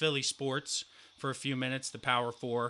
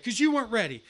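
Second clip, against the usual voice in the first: higher, louder sharp retort; coach snapping; 9 semitones higher, 5 dB louder, faster than usual. Retold or imitated. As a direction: snap back at him. Coach snaps back.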